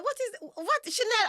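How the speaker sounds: high, loud imitation of a baffled relative; mock disbelief. Relative confronting Chanel about her outfit, in loud mock disbelief.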